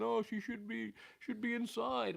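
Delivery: put-on high fussing old lady voice; mocking mother-in-law. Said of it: "But she said".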